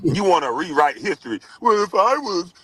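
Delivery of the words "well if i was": a light whiny mock of a rival player; lightly voiced whiny imitation of Kwame; mocking Kwame Brown. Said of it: "imagine Kwame Brown saying that".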